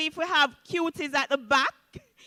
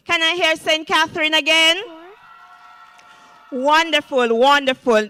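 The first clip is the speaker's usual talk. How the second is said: crowd hype; 6 dB louder than usual. Real, not acted. Host hypes the crowd.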